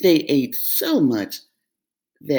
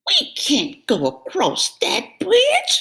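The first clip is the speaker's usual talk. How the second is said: high, slow fretful goat cry; worried cry; 13 semitones higher than usual. Acted. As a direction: dread the troll. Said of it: "cried Middle Billy Goat Gruff".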